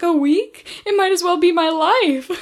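high, loud, hammed-up outrage; desperate TV addict. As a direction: imitate a melodramatic kid, laughter creeping in. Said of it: "suddenly gets desperate for their addiction".

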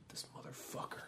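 breathy whispered contempt under the breath; indignant whisper. Retold or imitated. The stoic widower alone, whispering with indignant contempt. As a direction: hiss it like a man barely holding in his anger. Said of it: "just very indignant".

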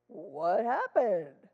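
loud, drawn-out mock bewilderment; imitated astonished grandma. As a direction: stretch it out like a stunned grandma. Grandma reacts to the tantrum.

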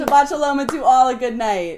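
loud sing-song farewell; sweet goodbye to the listeners; lovey dovey. Real, not acted. Sing-song sign-off echo.

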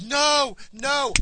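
very high, loud imitation of a panicking nurse; high mock-panic yell; retold frantic shout. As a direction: yell it as if reviving a dying patient. Nurse smacking patient she thinks dying.

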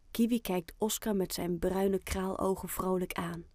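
plain reading voice

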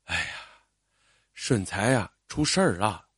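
low, soft sigh of bad news; sigh, then break the news heavily. A narrator's reading. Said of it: "妈妈叹了一口气". (叹着气低声说)

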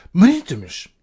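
loud high yell of the name; sharp, very loud call; shout. A narrator's reading. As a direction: yell to snap him out of it. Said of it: "schreeuwde Lucie".

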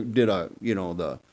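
ordinary talk

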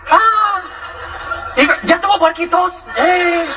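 high, slow, drawn-out comic delight; hammed-up country phone chatter; gossiping villager. Villager hogging the phone with pig news, comic voice.

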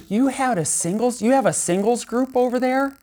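high, eager imitation of a curious woman; imitating the lady. Retelling the lady in line's eager question, high-voiced. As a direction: lift the voice up to play her excited question. Lady asks about singles group.

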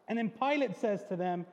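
ordinary delivery

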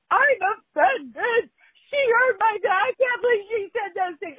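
high, loud whiny imitation of a crying woman; hammed-up wail of an offended woman; mock crying woman. Host imitating the crying offended woman. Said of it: "came by and was crying".

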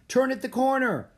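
put-on high, loud kid's command; urgent, excited holler; calling directions. A narrator's reading. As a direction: shout the turn to runners behind. Olive directing while running.

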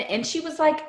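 ordinary talk

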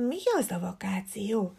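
hushed, breathless whisper; whispered to himself. A narrator's reading. Leopold whispers dreamily to himself in class. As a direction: whisper the question to yourself. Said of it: "Tűnjögte maga elé".

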